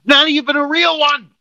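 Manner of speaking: very high, outraged exclamation; angry disbelief. Real, not acted. Host venting about the key sale, angry disbelief. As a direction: spit out the absurdity at high pitch. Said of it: "we're really not this angry".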